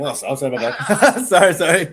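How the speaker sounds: mock-sorry through laughter; laughing sorry, sorry; laughing apology. Real, not acted. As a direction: apologise while cracking up. Laughing apology after correcting friend.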